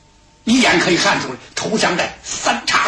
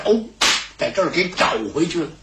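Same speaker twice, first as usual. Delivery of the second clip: ordinary delivery, nothing marked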